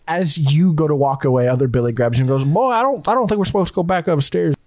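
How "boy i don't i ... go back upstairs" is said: drawling Southern guard; Southern accent. Acted. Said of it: "he's also just Southern".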